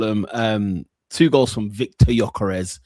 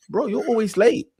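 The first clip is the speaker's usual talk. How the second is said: higher-pitched mock Northwest London accent; Isak; 9 semitones higher than usual. Host imitating a footballer scolding his teammate, mock London accent. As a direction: mimic London street talk, pitched up. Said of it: "He's talking like that".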